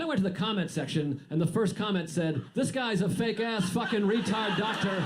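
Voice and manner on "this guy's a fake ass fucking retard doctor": slow, adamant mock internet commenter; mock angry commenter. Commenter insists "tinnitus" is tuh-NYE-tus, mock indignant. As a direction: drag it out like an all-caps comment. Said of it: "All caps".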